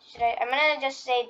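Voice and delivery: normal talking voice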